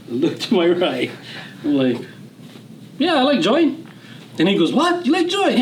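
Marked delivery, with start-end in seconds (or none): 3.0-3.8 eager reply. Retold or imitated. Teen eagerly volunteers. 4.7-5.6 high-pitched mock disbelief; shocked he actually said yes; surprised. Friend can't believe he wants to join, high surprised voice.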